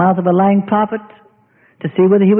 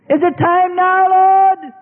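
high, whiny pleading voice; high whining plea to the Lord; impatient believer; 9 semitones higher, much slower than usual. Acted. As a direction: nag like a child in the fire.

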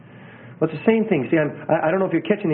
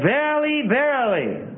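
high, drawn-out VERILY, repeated; Jesus; 9 semitones higher than usual. Retold or imitated. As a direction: proclaim verily twice with solemn weight. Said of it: "There are times that Jesus said".

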